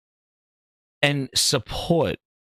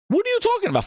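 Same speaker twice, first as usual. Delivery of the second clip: high, sharp question; exasperated disbelief; much faster than usual. Real, not acted. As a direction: throw it up in disbelief. Outraged disbelief.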